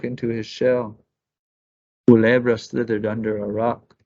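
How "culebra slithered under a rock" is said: plain reading voice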